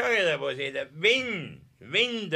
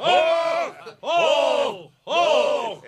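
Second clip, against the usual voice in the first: loud, high laughing hoch; laughing toast cheers; 8 semitones higher, 4 dB louder, much slower than usual. Acted. Guest laughing while cheering hoch, loud.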